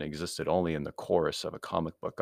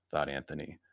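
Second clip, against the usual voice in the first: usual speaking voice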